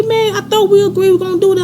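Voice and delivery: indignant, high-pitched objection; high-pitched protest. Retold or imitated. Acted protest in retold argument.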